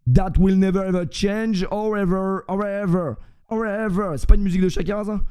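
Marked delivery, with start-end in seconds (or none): 0.1-4.2 put-on repetitive pledge in French-accented English. Streamer vowing lasting love for the club, French-accented English. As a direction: repeat it like a refrain, French accent. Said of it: "je suis en anglais".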